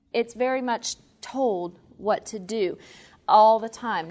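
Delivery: normal talking voice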